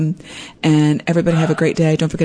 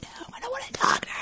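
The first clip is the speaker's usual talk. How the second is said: high, fast whispered refusal; mock whisper; 13 dB quieter, much faster than usual. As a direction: whisper it like you're hiding from the caller. Imagined Cookie avoiding her.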